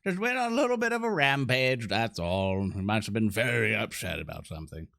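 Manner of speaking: smooth it over with stretched, swooping excuses; hurried excuse-making. Acted. Party member excusing the ogre to Coxo, placating. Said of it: "I come out quickly".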